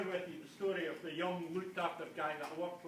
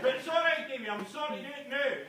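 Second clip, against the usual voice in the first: voicing Chris; 5 semitones higher, 7 dB louder than usual.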